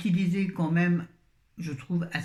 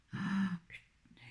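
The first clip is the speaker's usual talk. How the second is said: frustrated whisper; 2 semitones higher, 7 dB quieter, slower than usual. Real, not acted. Frustrated at stuck lid.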